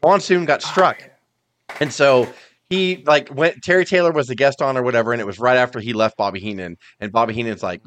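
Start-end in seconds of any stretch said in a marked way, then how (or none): none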